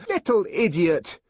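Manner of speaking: high, loud scolding burst; angry shout. A narrator's reading. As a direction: shout the insult at the child. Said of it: "she shouted".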